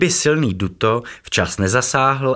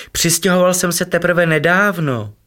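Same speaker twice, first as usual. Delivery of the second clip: much higher, louder, eager; joyful high voice; 10 semitones higher, 4 dB louder than usual. A narrator's reading. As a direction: still bursting with joy. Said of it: "vykřikl radostně". (radostně a vysoko)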